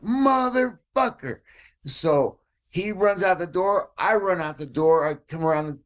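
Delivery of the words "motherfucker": drawn-out high angry curse. Retold or imitated. Kurt cursing the thief who bolted, drawn out and high. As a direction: spit it out as he bolts.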